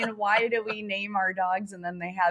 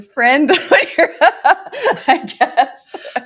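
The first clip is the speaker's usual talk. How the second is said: laughing; 7 semitones higher, 9 dB louder, slower than usual. Real, not acted. Laughs mid-sentence.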